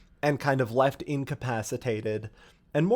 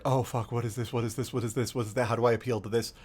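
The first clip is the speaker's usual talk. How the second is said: lower, softer, frantic muttering; fretful machine mind, low and hushed; panicked Vex mind; 6 semitones lower, 4 dB quieter, much faster than usual. Retold or imitated. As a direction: lower and quieter, trying to figure it out. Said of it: "And Quiria's like".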